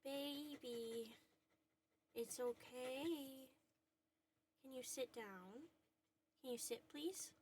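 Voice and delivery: soft soothing. Real, not acted. Calming trembling dog.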